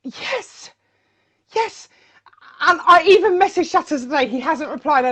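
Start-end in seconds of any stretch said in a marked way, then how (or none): 0.0-1.9 whispered high yes yes; breathy yes. Retold or imitated. Whispering yes to her friend about the jingles. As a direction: breathe out a starry-eyed yes. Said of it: "And I'm like".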